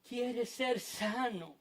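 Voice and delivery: soft and slow, lingering on each word; hushed, drawn-out question; gentle question. Acted. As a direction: ask it quietly, with great patience. Jesus gently repeats the question.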